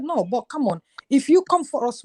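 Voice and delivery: ordinary talk, nothing marked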